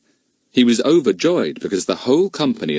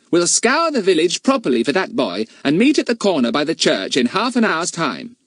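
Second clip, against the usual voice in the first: put-on bossy boy leader; 9 semitones higher, 3 dB louder than usual. A narrator's reading. Fatty gives orders.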